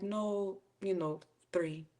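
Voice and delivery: quiet, easy counteroffer; relaxed haggling; calm. Retold or imitated. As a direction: say it coolly, no fuss.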